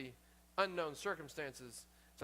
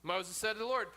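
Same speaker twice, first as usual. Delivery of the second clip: normal talking voice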